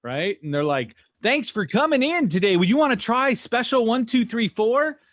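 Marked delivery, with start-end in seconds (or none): 1.2-5.0 high, loud, peppy promo voice; drive-through recording. Retold or imitated. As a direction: imitate a perky recorded sales greeting. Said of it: "drive-throughs have little recordings".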